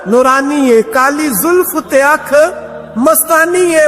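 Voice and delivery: slow, high, drawn-out verse recitation; devotional verse recitation. Acted. (نعتیہ اشعار کی ٹھہری ہوئی، اونچی ادائیگی)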